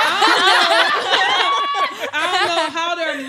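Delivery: high, slow laughing talk. Real, not acted. Woman laughing while telling friends about labor. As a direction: laugh your way through it.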